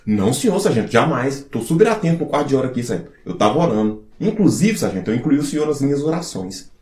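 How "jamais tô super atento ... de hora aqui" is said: normal talking voice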